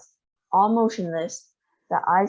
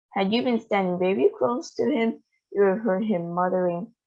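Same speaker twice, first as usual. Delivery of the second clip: ordinary delivery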